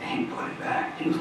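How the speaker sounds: ordinary talk, nothing marked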